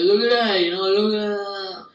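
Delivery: hammed-up loud bragging, stretching the last words; proud guy showing off. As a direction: belt it out as a delighted guy. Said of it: "He's like".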